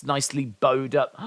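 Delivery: normal talking voice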